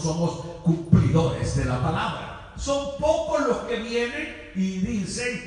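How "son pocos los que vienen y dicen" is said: ordinary talk, nothing marked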